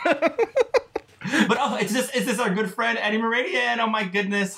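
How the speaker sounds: high relieved laughing; laughing with relief. Real, not acted. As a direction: laugh off the scare. Scared by surprise guest, laughs.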